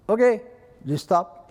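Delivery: high, loud quoted reply; imitated guard's order. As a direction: raise pitch and volume to cut them off.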